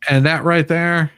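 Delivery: pointing it out, thrilled to show her; excited. Real, not acted. Showing Mary the video.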